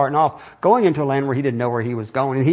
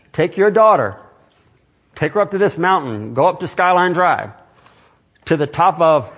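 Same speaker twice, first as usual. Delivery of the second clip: commanding voice of God; 3 semitones higher, 3 dB louder than usual. Acted. Preacher voicing God ordering Abraham, loud and commanding. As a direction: speak as God giving a terrible order.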